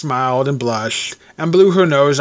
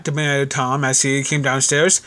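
plain reading voice

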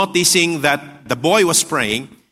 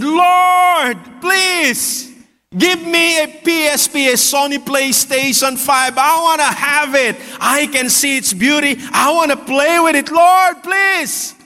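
screamed plea; 7 semitones higher than usual. Acted. Boy shouting a prayer for a PlayStation, in a screamed plea. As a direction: cry out high, drawing out the pleading words. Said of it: "the boy screamed".

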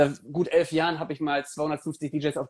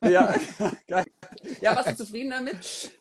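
lachend; 9 semitones higher than usual. Real, not acted. Laughing with customer.

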